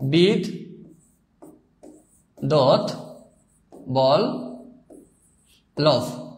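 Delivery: বিদ্যুৎ Vallabh very slowly, broken into bid, dot, bol, lobh; drawn-out syllables with long pauses in বিদ্যুৎ Vallabh. Shown as a demonstration. Teacher demonstrating pronunciation to students, বিদ্যুৎ Vallabh split into four slow syllables. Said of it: "অর্থাৎ".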